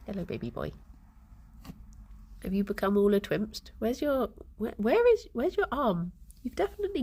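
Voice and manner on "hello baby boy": tender pet greeting. Real, not acted. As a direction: coo gently at the cat.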